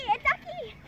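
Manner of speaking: high delighted cry; excited. Real, not acted. Child repeats duck sighting.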